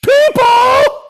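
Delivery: very high, loud, drawn-out stage scream. Acted. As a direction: holler to the arena like a rock frontman. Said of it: "And then there's on stage [name]".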